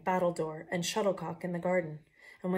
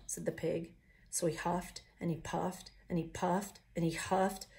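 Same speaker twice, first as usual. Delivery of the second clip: plain reading voice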